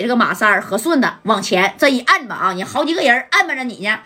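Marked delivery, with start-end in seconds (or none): none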